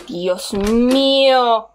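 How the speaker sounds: very slow, stretched exclamation; long, wailed oh-my-God; drawn-out dread. Real, not acted. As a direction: drag out the last word in dread. Nervous in the dark.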